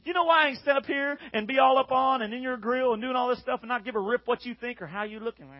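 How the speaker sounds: usual speaking voice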